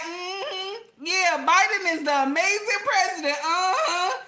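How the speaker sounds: high, put-on gushing praise with rolled-eye sarcasm; mock sincere praise. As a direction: gush over him but plainly not mean it. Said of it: "I would have been looking at like".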